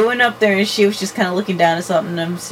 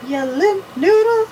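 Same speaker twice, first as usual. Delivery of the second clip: high squeaky voice; 12 semitones higher, slower than usual. Pretend. High silly quote.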